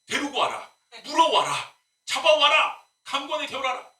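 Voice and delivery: mock-furious preacher yelling orders; angry ranting. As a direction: rant the orders like an angry pastor. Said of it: "처음에는 화가 났죠".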